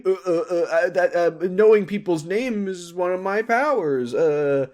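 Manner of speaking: high, stammering cover-up; flustered stammer. Retold or imitated. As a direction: stumble over the words, caught out. Billy covering a slip.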